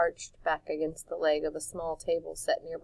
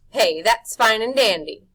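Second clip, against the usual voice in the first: polished smile; 4 semitones higher, 11 dB louder than usual. A narrator's reading. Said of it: "freshly polished smile".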